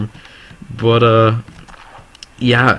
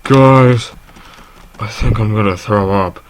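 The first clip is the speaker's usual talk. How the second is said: about to vomit; 5 dB louder, slower than usual. Retold or imitated. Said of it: "so pale, and I was, like, sweating".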